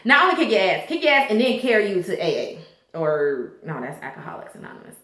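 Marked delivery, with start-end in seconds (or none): 2.9-5.0 soft unvoiced self-correction; whispered aside. Real, not acted. Vlogger correcting herself under her breath, whispering. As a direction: drop to a whisper to fix it.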